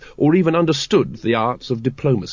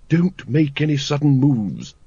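slow, carefully spaced warning; measured character voice of a lady giving expert advice; Lady Ramkin; 2 semitones higher than usual. A narrator's reading. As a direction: advise slowly and deliberately. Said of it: "said Lady Ramkin's voice behind him".

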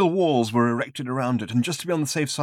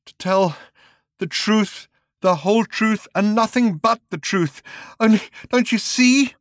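rising higher and louder; building agitation; 10 semitones higher, 6 dB louder than usual. Acted. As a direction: build from shaky to frantic. Raving man building to shout.

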